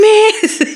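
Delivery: bright cackle right after; high burst of laughter. Real, not acted. Laugh after retold reaction.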